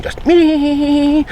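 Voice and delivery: high, piercing one-tone alarm beep sound; imitated bite alarm. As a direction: mimic the screaming bite alarm.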